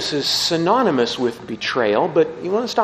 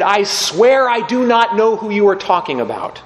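loud, higher, desperate swearing; desperate oath; 7 semitones higher, 5 dB louder than usual. Retold or imitated. Peter swearing to the crowd he never knew Jesus, loud and desperate. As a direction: deny it with everything you have.

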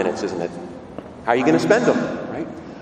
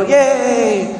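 high-pitched, drawn-out mock cheer; imitated crowd cheer, high and long; 9 semitones higher, 5 dB louder than usual. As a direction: cheer like an amazed circus crowd. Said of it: "and the crowd go".

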